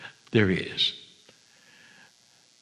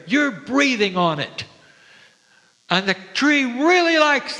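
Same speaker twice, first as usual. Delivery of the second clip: voice of love; 5 semitones higher than usual. Pretend. Love invites the listener to hug the tree, higher marvelling. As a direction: wonder at each word, stretching it out.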